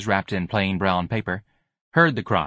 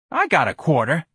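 higher, cocky sneer; 7 semitones higher, 3 dB louder than usual. A narrator's reading. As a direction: taunt him with the coin.

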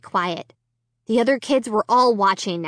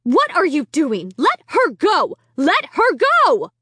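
higher, louder frantic shouts; a mother desperate to free her child; 7 semitones higher, 4 dB louder than usual. A narrator's reading. Mother yelling at the boy while tugging his arm. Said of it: "frantically tugging my arm".

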